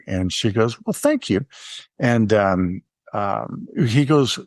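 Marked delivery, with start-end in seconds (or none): 0.9-1.4 high, pleased thanks; excited barista. Retold or imitated. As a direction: imitate a delighted barista's thanks. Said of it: "to be that excited".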